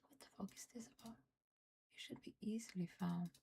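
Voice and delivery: fast whispered complaint to yourself; annoyed whisper. Real, not acted. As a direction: grumble it in a rushed whisper. Whispering annoyed at hidden button.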